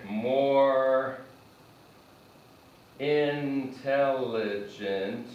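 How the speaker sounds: slow, words held apart; slow model phrase. Shown as a demonstration. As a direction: dictate it slowly while writing. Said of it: "let's do this in red".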